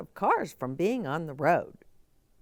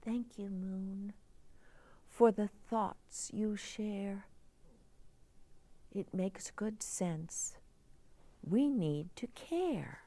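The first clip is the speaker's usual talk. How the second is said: put-on young girl character; 8 dB quieter than usual. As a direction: play the worried little girl asking for help. Girl thanks the Moon.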